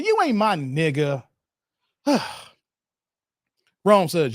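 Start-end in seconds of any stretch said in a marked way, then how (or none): none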